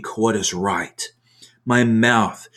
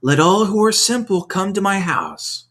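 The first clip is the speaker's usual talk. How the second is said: high, ringing call; 9 semitones higher than usual. A narrator's reading. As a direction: call it out across the city. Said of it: "she calls from the highest points".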